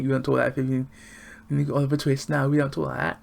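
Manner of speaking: high, loud teasing objection; mock protest. Reviewer ribbing Oscar Isaac over his English accent, mock indignant. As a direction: pitch it up in playful indignation.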